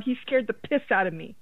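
usual speaking voice

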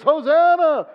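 high, loud festive shout; crowd cheer. Retold or imitated. Preacher voicing the crowd cheering Jesus, loud and high.

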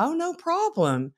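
high, light and airy polite brush-off; a sweet, high-pitched no problem; breezy mock polite. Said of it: "I'm like".

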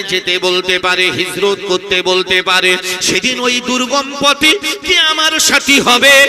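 very loud, half-sung preacher's delivery; full-force preaching. Acted. Preacher retells the Prophet and Abu Bakr to the congregation, loud chanted preaching.

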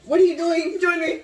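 loud, high-pitched call. Real, not acted. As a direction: shout over to a friend. Calling loudly to a friend.

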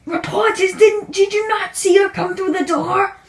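ordinary delivery

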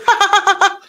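high, mechanical ha-ha-ha; exaggerated high cackle; mock creep laugh. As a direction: imitate him laughing on and on.

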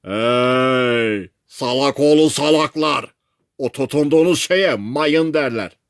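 scolding call through a megaphone; megaphone shouting. A narrator's reading. Sailor warns castaways about the mine, megaphone shouting. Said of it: "Megafonla bağırmaya başladı".

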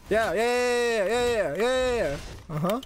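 high, drawn-out chain of cheers; gloating cheer. Real, not acted. Player celebrates mid-game, high gloating cheer. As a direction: crow it out in triumph.

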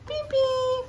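very high, playful beep-beep; car horn imitation. Mother showing baby the car, playful horn noises. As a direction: squeak out a toy car horn.

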